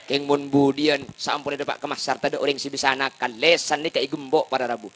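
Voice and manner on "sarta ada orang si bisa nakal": normal talking voice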